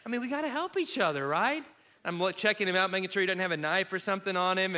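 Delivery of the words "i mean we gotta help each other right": high, laughing fake indignation; trying to act normal in front of the stranger; mock outrage. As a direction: ham up the disapproval to cover. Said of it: "I had to play this off".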